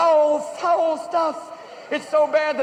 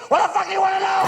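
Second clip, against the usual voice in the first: high-pitched dad outburst; exasperated shout; 6 semitones higher, faster than usual. Acted. Dad snapping at his son's questions, exasperated shout. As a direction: lose all patience and yell it.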